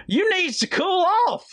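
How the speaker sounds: stretched, high scolding cry; screamed insult. Retold or imitated. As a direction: screech it like a woman scolding her husband. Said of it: "She screamed".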